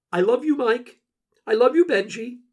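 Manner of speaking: high, loud declaration of love; Jackie's character voice. A narrator's reading. Jackie to Mike and Benji at parting, high loud character voice. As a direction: lift the voice high and project each goodbye.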